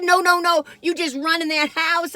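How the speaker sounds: high, loud no no no; urgent insisting. Retold or imitated. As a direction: press him to just run inside. Urging neighbor to flee bear.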